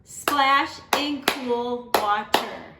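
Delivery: very slow, syllables spaced for claps; teacher clapping the final line; clapped out syllable by syllable. Shown as a demonstration. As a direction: beat out each syllable. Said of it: "our last line".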